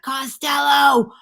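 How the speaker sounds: high, loud, slow yell; director yelling. Retold or imitated. Angry director yelling at actress mid-take. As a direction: bellow it at the actor across the set. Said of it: "he's like".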